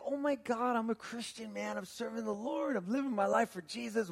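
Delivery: shocked disbelief. Retold or imitated. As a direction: plead innocence softly, as if stunned. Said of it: "And I was shocked".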